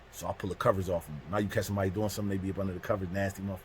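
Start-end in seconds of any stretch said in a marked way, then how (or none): none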